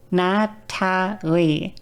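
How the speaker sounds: each syllable drawn out very slowly; slow, effortful. Shown as a demonstration. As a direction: build the name sound by sound. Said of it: "What I would do is I would go".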